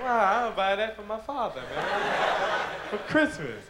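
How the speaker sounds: hammed-up TV ad pitchman gushing over a gift; cheesy commercial guy. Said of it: "the guy go".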